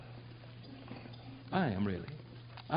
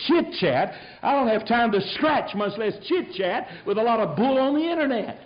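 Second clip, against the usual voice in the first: retold retort; 9 semitones higher, 5 dB louder than usual. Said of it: "Well, I said".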